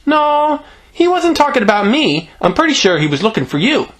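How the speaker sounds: put-on high-pitched child's correction; insistent little girl. Greta tells Bill who was wanted, high insistent voice.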